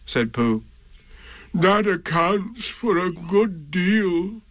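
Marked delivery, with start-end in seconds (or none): 1.5-4.4 slow, higher gloomy donkey; resigned and glum. A narrator's reading. Eeyore to Pooh about his lost tail, slow and gloomy. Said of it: "said Eeyore gloomily".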